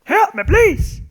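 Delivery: high, loud imitated plea; mock shout for help. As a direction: yell it like the game voice. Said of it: "That's the one".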